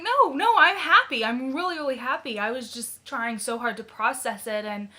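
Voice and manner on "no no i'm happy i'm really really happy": high, loud retold protest; insistent protest. Patient assuring the ultrasound tech she is happy, high and insistent. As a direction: insist brightly, acting out an earlier denial.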